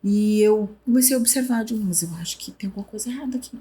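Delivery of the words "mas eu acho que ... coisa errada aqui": softer, fast inner voice; quiet thought. Retold or imitated. Realizing something is wrong, quiet and fast. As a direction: murmur it quickly to yourself.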